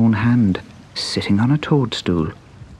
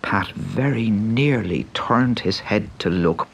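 ordinary delivery

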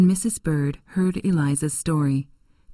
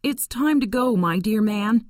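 higher, lightly voiced sorrow; a mistress saying goodbye; tearful Missus Shelby; 5 semitones higher than usual. Missus Shelby saying farewell to Tom, a touch of tears. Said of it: "stood there crying".

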